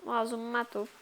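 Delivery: ordinary talk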